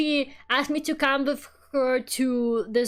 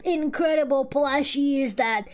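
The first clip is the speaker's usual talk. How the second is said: mock hype; 3 semitones higher, slower than usual. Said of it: "and they're like".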